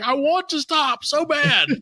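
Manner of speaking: high, slow mock plea; hammed-up helpless protest, drawn out; silly stuck voice. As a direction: whine it in a stupid high voice. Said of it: "stop doing that stupid voice".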